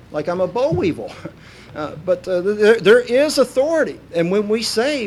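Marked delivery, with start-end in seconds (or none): none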